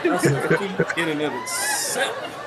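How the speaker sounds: laughing while talking; chuckling through the joke. Real, not acted. Joke about ingrown hairs.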